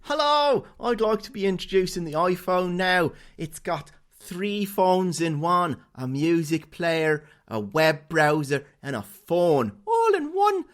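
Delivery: loud, high, hammed-up Irish accent; bad Irish-tinged accent. Co-host mocking an actor's Steve Jobs, hammed-up Irish accent. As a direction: belt out a product launch in a silly, drawn-out Irish accent. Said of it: "This, this Steve Jobs is a bit Irish".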